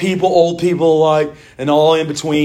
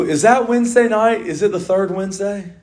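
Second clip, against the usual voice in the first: ordinary talk, nothing marked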